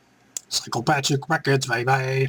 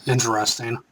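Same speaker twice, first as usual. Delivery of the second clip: normal talking voice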